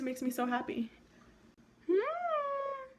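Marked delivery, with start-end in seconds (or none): 1.9-2.9 high pleased hum. Real, not acted. To her viewers, admiring her glow, a high pleased hum.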